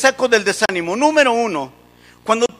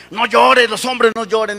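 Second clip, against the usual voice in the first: mock yell, loud at first; retold father's yell; 5 dB louder than usual. Dad yells at his crying little boy, mock shout. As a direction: shout it like a scolding dad, easing off. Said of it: "le grita".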